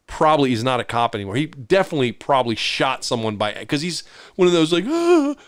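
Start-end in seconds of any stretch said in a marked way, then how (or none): 4.9-5.3 high jumpy cop yelp; mock startled high shriek; nervous yelp. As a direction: squeal like a panicky cop.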